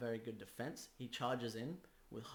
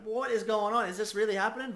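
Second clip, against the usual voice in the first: much higher and louder disbelieving outcry; stunned crowd; 8 semitones higher, 11 dB louder than usual. Retold or imitated. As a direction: play the crowd losing its mind in shock. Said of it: "The crowd's like".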